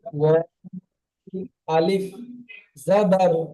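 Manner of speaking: জাবার ও, ending on a short open ও; ওয়া আলিফ জাবার ও, each part spaced out; Quran letter drill. Shown as a demonstration. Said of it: "একবার বলে দিন".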